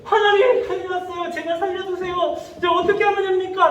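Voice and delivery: high mock wail to God; panicked crying out. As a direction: cry out for rescue in acted panic. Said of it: "부르짖었다는 거예요".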